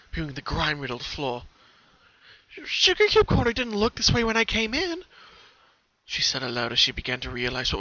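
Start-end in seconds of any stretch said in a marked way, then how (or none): 2.6-5.0 put-on much higher, louder girlish voice; pony notices the shop has changed, alarmed; Pinky, realizing. A narrator's reading. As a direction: piece it together aloud, alarmed.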